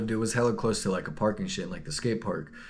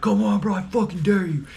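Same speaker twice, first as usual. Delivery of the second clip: high, loud taunting challenge; imitating a buff uncle yelling a challenge at the van; trash-talk shout; 10 semitones higher, 6 dB louder than usual. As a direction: dare him to fight, yelling. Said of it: "he's just talking all this shit".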